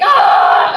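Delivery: loud, high yell; imitated battle scream. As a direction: scream like a mom charging to the rescue. Mom charges out with stick.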